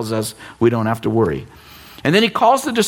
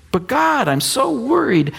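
high, slow whining worrier; mock worried whine; 9 semitones higher, slower than usual. Pastor mimics a congregant's fretful prayer, slow high whine. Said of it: "And we say".

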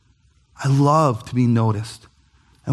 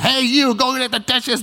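bossy wife; 9 semitones higher than usual. Retold or imitated. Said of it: "instead of saying".

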